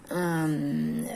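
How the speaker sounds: ordinary delivery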